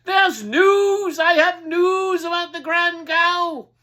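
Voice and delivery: loud high crier's call; town crier shouting. Acted. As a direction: bellow it through the streets. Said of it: "shouting out".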